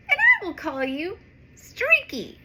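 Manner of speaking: bright cheerful superhero promise; Supergirl voice. Acted. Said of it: "All right, here she is".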